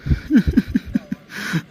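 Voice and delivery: high, voiceless breathy laugh-groan; a breathy laugh through the pain. Acted. Baba ji in pain, a high voiceless laugh-groan.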